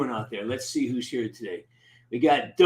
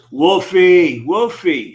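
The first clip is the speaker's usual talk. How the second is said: louder happy call of a name; 7 semitones higher, 12 dB louder, slower than usual. Real, not acted. Streamer greeting a regular in chat, louder call. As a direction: hail a friend across the room.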